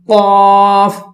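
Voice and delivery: قاف said full and heavy, vowel held long; thickened. Shown as a demonstration. Teacher demonstrating a green-marked letter, thick heavy قاف. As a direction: make قاف thick and draw it out. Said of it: "اس کو ہم موٹا کر کے پڑھیں گے".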